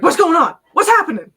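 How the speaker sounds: startled cries of a creature caught off guard; yelping what's going on; startled outburst. Acted. Startled character jumps up.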